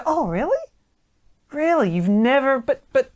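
high, incredulous teasing; sky-high playful "really?"; mock disbelief. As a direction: act shocked to needle her. Teasing a woman's answer.

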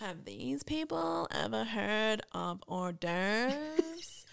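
very slow LA drawl; mock Kardashian. Joining the co-host's bit, Kardashian drawl. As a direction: drag it out in vocal fry. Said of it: "like the Kardashians".

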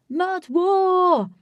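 high, loud, drawn-out yell; shouted battle cry. A narrator's reading. Link starting a mud fight, shouting. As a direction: holler it like a call to battle. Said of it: "Link shouted".

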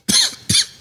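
harsh cough after a hit; real coughing fit. Real, not acted.

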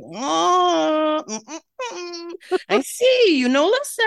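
put-on high, loud, drawn-out French-accented disapproval; Tanita's French accent. Facialist inspecting client's skin, high disapproving French accent. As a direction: tut like a hammed-up French facialist. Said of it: "she's got a French accent".

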